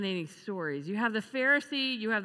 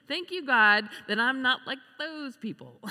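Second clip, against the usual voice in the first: mock-proud, louder and higher self-congratulation; proud prayer; 4 semitones higher, 6 dB louder than usual. Pharisee thanking God he's not like others, mock-proud. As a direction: put on a mildly pompous, pleased-with-yourself prayer. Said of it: "It's so proud".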